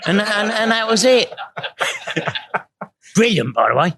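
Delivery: laughing while telling the punchline. Real, not acted. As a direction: voice breaking into laughter. Laughing at the band's joke.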